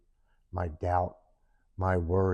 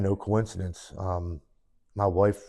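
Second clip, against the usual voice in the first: normal talking voice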